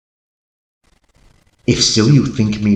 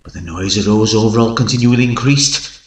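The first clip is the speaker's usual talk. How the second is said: usual speaking voice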